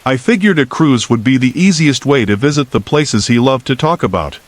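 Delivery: ordinary delivery, nothing marked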